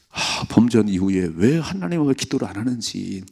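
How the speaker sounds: usual speaking voice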